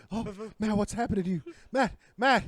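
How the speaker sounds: horrified. Acted. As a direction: build panic at the sight. Acting horrified witness.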